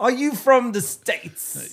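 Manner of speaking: very high, slow mock-British question; cartoonish high London local; hammed-up London guy. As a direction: stretch it out in a cranked-up London accent. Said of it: "I was trying to be the guy".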